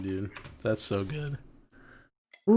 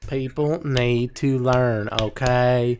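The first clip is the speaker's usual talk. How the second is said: slow mock lecture; much slower than usual. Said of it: "mock preachy lesson".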